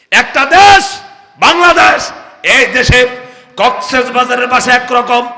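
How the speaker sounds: loud, high preaching call; full-force sermon shout; fiery preaching. Acted. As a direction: preach it out to the crowd.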